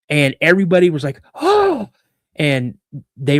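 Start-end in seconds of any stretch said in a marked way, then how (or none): 1.3-1.9 high, drawn-out mock gasp; shocked gasp. Pastor's family sees naked man, shocked gasp. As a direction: gasp aloud in acted shock.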